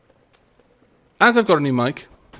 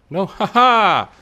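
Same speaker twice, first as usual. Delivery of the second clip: loud triumphant slow laugh; gloating laugh; 9 semitones higher, 11 dB louder, much slower than usual. Real, not acted. Gloating as enemy falls for trick.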